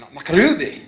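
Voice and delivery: "MacRuby" bellowed like a clan name, "muh-KROO-bee"; mock pronunciation. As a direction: proclaim "MacRuby" with exaggerated gusto. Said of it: "we have to say hot cocoa".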